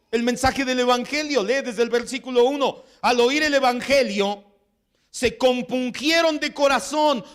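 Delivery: high, loud, fervent preaching; loud, high, impassioned sermon delivery; full-force preaching. Acted. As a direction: drive the passage home with fire.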